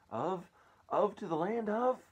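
high, loud, held-out searching words; building suspense. A narrator's reading. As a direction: stretch it upward, groping for the name. Ladybird stalls over the destination.

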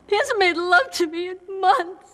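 high, slow, crying wail; wife breaking down to her mentor over her husband; sobbing. Acted. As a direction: let it collapse into sobs.

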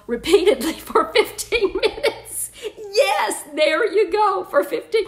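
high, loud delighted exclaim; cheering the idea on; excited. Real, not acted. Host reading comments, delighted by an idea.

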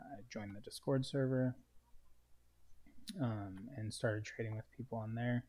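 ordinary delivery

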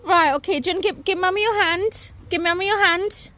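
high, bright, coaxing parent voice. Real, not acted. Mother coaxing her muddy toddler up, high coaxing voice. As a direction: coax a little one gently, up high.

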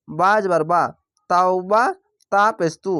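normal talking voice

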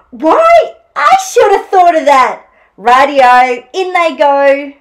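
gasp, then high loud exclamation; hammed-up astonished delight; delighted Dingo. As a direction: burst out at a brilliant idea, then call it in. Dingo delighted by Echidna's idea.

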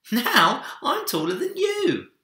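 put-on high, loud child's boast; triumphant little boy. A narrator's reading. Minik boasts to Big Bear, high and loud. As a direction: crow it from the snow mound like a small boy. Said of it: "he laughed".

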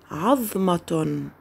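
عظمة said very slowly, the middle ظ held; careful slow عظمة; slow model word. Shown as a demonstration. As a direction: spell out عظمة slowly for the class. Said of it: "لاحظوا حرف الضاء في وسط الكلمة".

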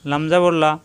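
ordinary talk, nothing marked

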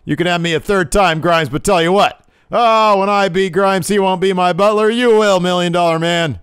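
loud, high wrestling-promo shout; brash challenge. Retold or imitated. L A Knight challenging Million Dollar Man, loud and brash. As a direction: call him out like a heel on the mic.

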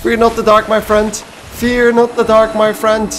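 high, anxious echo of a game line; nervous quoting. Retold or imitated. Scared player quoting the game's line, high nervous voice. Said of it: "I am fearing it".

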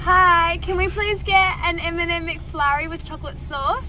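high, bright customer voice; polite ordering voice. Real, not acted. Ordering at the drive-through speaker.

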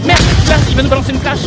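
high, loud alarmed warning; alarmed warning shout. Real, not acted. Warning of incoming flashbang.

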